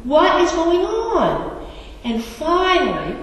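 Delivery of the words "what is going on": exasperated father. Retold or imitated. Father to crying son in the back seat, exasperated. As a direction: imitate a parent at wit's end. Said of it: "The parents are getting exasperated".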